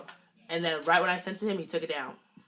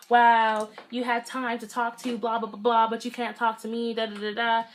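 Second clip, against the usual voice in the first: a higher, louder huffy "Wow" that trails into mocking blah-blah; salty mockery; 5 semitones higher, 5 dB louder than usual. Narrator mocking the salty ex's jealous text. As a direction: mimic a jealous boyfriend whining. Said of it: "he got so salty".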